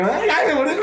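high-pitched, laughing mock yelps; mock pained squeal. Imitating someone bitten by a dog, mock pained squeal. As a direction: act out a silly, high-voiced dog lover.

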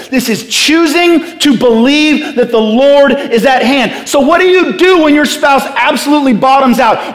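high-pitched, insistent sermon voice; impassioned preaching. Real, not acted. Preacher urging the church to choose belief, impassioned. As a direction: build conviction and rally the room.

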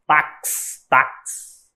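"Tax" with the final ks hissed out; sound demonstration. Shown as a demonstration. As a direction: say "tax" slowly apart, lingering on the ks. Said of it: "A mesma coisa do tax".

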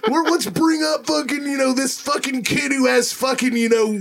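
mocking a band bringing up a fan on stage, hammed-up shout; mock arena frontman. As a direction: hype the crowd like a cheesy rock frontman. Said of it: "That's what it's like".

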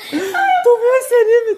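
very high slow squealing question; excited squeal. Real, not acted. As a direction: squeal it with delight. Squealing excitedly over anime.

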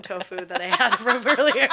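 laughing while talking; giggling through the words. Real, not acted. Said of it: "You know how my mouth is still numb?".

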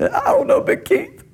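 very high, small frightened voice; scared kid voice. Retold or imitated. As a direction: squeak it out like a scared teen. Young self answering scared.

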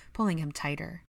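plain reading voice

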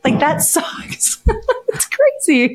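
high, breathless giggle through the words; real laughter breaking up speech; laughing while talking. Real, not acted. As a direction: lose it halfway and keep talking. Laughing at her own weird dream.

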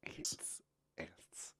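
hushed, unvoiced whisper of one word; soft eerie whisper; whispered ants. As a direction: whisper it very softly, drawn out. Intro chant of the episode topic.

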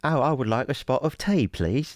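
high-pitched, hammed-up British accent. Shown as a demonstration. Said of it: "trying to say it the way they said it".

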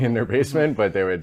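amused self-correction with a laugh. Real, not acted. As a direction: chuckle through the correction.

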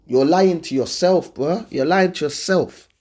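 ordinary talk

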